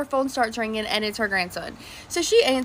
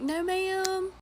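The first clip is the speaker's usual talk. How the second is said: meek polite no; 6 semitones higher, 4 dB quieter than usual. Retold or imitated. Polite refusal to old lady.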